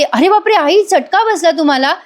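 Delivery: high, alarmed imitation of a panicked young wife; startled, worried cry, pitched well up; panicked daughter-in-law. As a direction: gasp it out in alarm at the burn. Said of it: "Sayali खूपच panic होऊन जाते".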